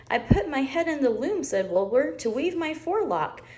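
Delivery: high, gleeful lamb voice; high excited Wilbur; delighted little lamb. Acted. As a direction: beam with pride, way up high. Wilbur proud.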